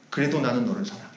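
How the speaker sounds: soft and low, lovingly; quiet, tender I love you; tender Jesus voice. Acted. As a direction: say it like a gentle promise. Jesus speaking love to sinner.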